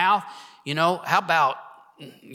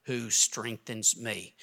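quiet; 7 semitones lower, 7 dB quieter than usual. Acted. Quiet scripture reading.

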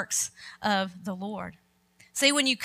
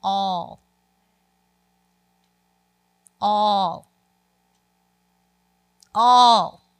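"all" repeated, getting much louder each time; preacher driving home that every tithe is owed, rising to loud; building stress on all; 9 dB louder, much slower than usual. Shown as a demonstration. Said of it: "Not part of it".

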